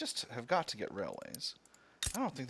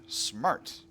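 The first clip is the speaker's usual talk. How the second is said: ordinary talk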